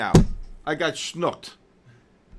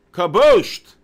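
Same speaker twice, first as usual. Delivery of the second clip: loud, high victory cry; celebrating a chatter's win, shouting; triumphant shout; 4 semitones higher, 12 dB louder than usual. Real, not acted. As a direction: crow it like you just hit the jackpot. Celebrating a winning trade.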